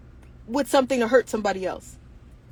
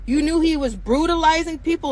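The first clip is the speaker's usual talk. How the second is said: usual speaking voice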